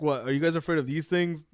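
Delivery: ordinary talk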